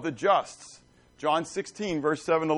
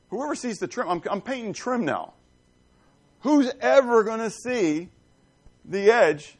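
high, scoffing who-would-ever-notice voice; mock incredulous question; 9 semitones higher, 4 dB louder than usual. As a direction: scoff at the idea anyone looks. Mocking a lazy painter's shortcut.

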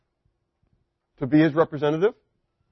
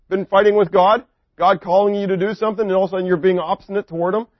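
ordinary delivery

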